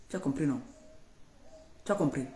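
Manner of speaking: normal talking voice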